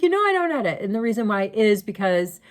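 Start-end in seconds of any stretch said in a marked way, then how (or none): 0.0-0.8 high, bright "You know, I don't edit"; breezy dismissal. Retold or imitated. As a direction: brush it off lightly. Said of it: "I was just like".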